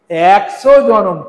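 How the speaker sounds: "একশো" drawn out loud and slow; emphatic. Real, not acted. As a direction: lean hard on "একশো", stretching it. Stresses number before quizzing listeners.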